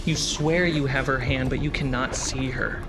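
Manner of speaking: usual speaking voice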